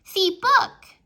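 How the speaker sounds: put-on loud little-kid insistence. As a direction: toddler demanding to be noticed. Toddler repeats louder.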